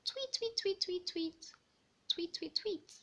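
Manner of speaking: high chirping tweets, mostly whispered; bird chirps. Acted. Narrator voicing forest birds chirping for the children, high and airy.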